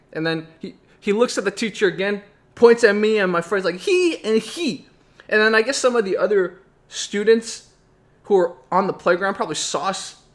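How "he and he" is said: very high, slow mock-child accusation; limited-English boy. Boy with little English points out two culprits, high and slow. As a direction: draw out each word in a squeaky imitation. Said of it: "His English was very limited".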